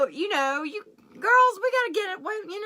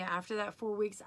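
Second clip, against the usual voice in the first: ordinary talk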